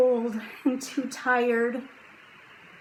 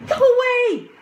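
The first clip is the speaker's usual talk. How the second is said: high, loud shout to scare off a wolf; 9 dB louder than usual. A narrator's reading. Jack yelling at the wolf to scare it off, loud high shout. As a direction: yell it out, sharp and high. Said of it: "he shouted".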